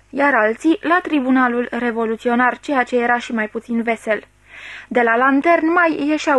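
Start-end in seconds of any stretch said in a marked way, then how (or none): none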